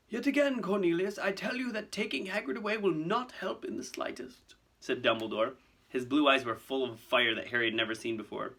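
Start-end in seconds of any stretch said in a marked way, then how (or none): 0.1-4.6 high, measured, insistent voice; firm old headmaster. Acted. Dumbledore objecting to Hagrid being taken, insistent.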